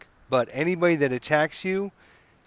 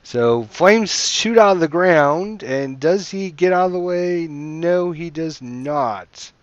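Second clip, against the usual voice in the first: loud, raised, drawn-out suspense; game master narration; 4 semitones higher, 6 dB louder, slower than usual. Acted. Game master describes the spell striking, dramatic narration. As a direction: build the suspense, then stretch out the verdict.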